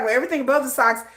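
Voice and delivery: ordinary talk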